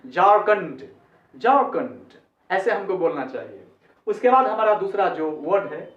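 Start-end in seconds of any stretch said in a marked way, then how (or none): 0.1-2.2 झारखंड as "jaar-kund", r sounded; English with R. Shown as a demonstration. Teacher corrects for the R, झारखंड as "jaar-kund". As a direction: keep the r in झारखंड, no aspiration. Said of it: "आर को भी बोलना है".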